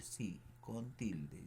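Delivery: "Sí, con tilde" said slowly and softly, with a firm fall on "con"; slow, firm confirmation of "Sí, con tilde"; slow emphatic. Shown as a demonstration. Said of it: "Ese sí, con tilde".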